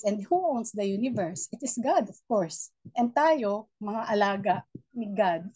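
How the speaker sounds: ordinary talk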